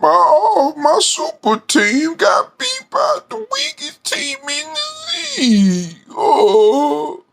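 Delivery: high, slow fake sobbing wail; hammed-up crybaby lament over the Spurs; mock crying Shannon. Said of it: "that emphasis on the Spurs when you".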